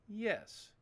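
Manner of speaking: normal talking voice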